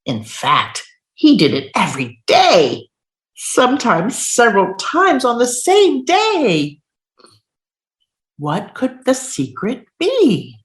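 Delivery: lively storybook voice, building suspense; reading to children. Acted. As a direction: read it big for little kids. Reads picture book aloud.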